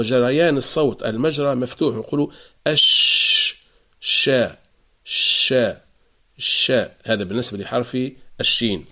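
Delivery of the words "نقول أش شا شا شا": ش and شا said slowly with open air; shin drill. Shown as a demonstration. Teacher drilling shin's spreading sound. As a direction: let ش flow then open to شا.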